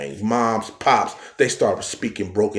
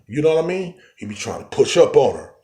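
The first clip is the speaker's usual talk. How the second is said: usual speaking voice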